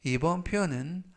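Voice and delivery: ordinary delivery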